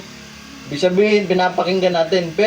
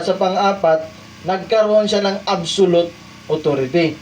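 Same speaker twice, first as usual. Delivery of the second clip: usual speaking voice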